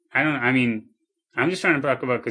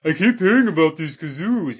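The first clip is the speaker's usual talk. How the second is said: higher, louder put-on Canadian accent; 5 semitones higher, 6 dB louder than usual. Showing off a Canadian accent, pitched up and loud. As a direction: ham up a Canadian accent for a friend. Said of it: "let me do a Canadian accent".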